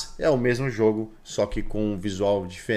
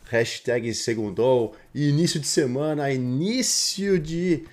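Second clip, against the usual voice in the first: high, hyped show opener; hype announcer; 6 semitones higher, slower than usual. Acted. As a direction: belt out the welcome like a presenter.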